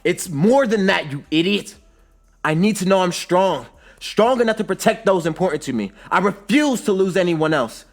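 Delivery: impassioned, high, loud retort; heated shouting, sustained to the end; yelling back. A narrator's reading. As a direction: yell back with fierce conviction. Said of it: "Sasuke yells back".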